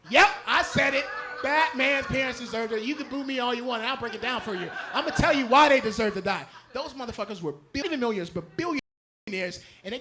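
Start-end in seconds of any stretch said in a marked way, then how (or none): none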